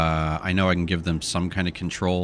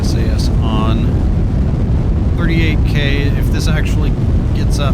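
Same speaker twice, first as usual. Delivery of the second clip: loud raised voice over engine roar; shouting over engine; 2 semitones higher, 7 dB louder than usual. Real, not acted. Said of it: "I know I'm yelling over the, uh, the engine".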